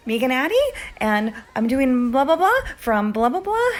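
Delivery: high, loud, questioning self-introduction; squeaky nervous freshman introducing herself; old high-pitched voice. Retold or imitated. Nervous student introducing herself to the acting teacher, high-pitched and rising. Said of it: "in what was then my very high-pitched voice".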